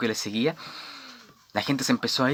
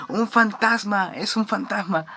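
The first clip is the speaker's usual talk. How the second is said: terrified cry; 8 semitones higher than usual. Retold or imitated. Disciples in the storm shrieking at the shape in fear. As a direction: shriek it like frightened fishermen seeing a ghost. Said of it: "empiezan a gritar".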